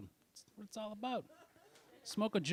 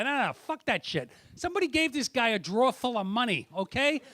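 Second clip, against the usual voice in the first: louder, higher retort; retold heated reply; 7 semitones higher, 7 dB louder than usual. Comic arguing with the woman in line, a loud heated retort. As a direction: push back hard at her.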